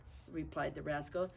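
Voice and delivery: usual speaking voice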